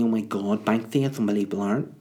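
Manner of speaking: fast, put-on gushing fan voice; mock gushing. Mocking people who gush over a Banksy picture, fast and put-on. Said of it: "everyone's like".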